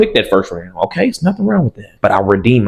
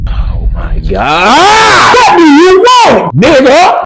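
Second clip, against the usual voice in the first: anguished wailing shout; 8 dB louder, much slower than usual. Retold or imitated. Wailing over stepdad text.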